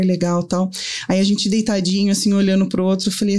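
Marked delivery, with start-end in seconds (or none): none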